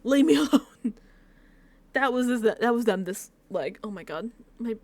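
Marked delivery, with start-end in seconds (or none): 0.0-0.9 higher, louder dismissal breaking into laughter; mock irritation. Irritated contestant snapping at a partner, playful mock annoyance. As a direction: push them away, half laughing. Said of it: "I'm so irritated".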